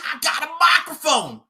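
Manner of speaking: high, loud mocking yell; taunting shout. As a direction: hurl it at the opponent.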